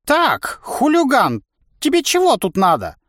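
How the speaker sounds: put-on high, loud, furious old creak; angry creaky old man. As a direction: snarl it like a cranky ancient. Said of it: "разозлился".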